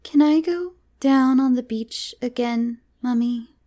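put-on high, slow, earnest child voice; earnest little girl. A narrator's reading. Miranda asks Mummy to return to the beach, earnest. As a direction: ask earnestly as a small child. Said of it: "said Miranda earnestly".

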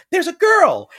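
put-on high, loud excited cry as young Dorothy. Dorothy spotting the girl in the mirror, excited high cry. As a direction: point and cry out, thrilled. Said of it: "but she's like".

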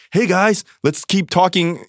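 high, slow and chirpy, keeping the talk going; mock upbeat host urging everyone on; lightly hammed-up eager host; cheery host voice. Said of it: "ad nauseam".

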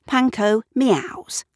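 plain reading voice

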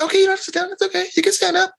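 mock the pushover parents sweetly; sarcastic high baby-talk giving in. Mocking the yes parents.

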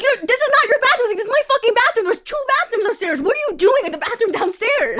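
high, imitated indignant rant; mock angry rant. Retelling the boyfriend berating the creep over the bathroom, high mock rant. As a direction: scold him like the outraged boyfriend.

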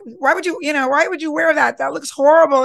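mock a friend's outfit, loudly; snide put-down. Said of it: "And I'm like".

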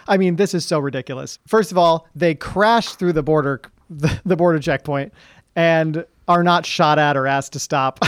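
ordinary talk, nothing marked

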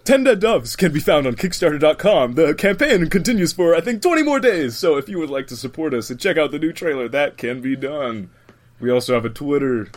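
bright upbeat promo read; YouTuber voice. Pretend. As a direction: hype it like a channel plug. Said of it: "I'm pulling out the YouTuber voice here".

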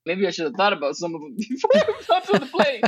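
high voice breaking into real laughter; laughing. Real, not acted. Speaker laughing at herself about jumping on the plane, laughing. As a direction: laugh through the words at your own folly. Laughing at her own panicked thoughts.

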